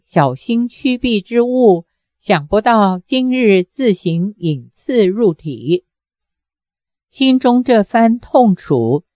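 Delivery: usual speaking voice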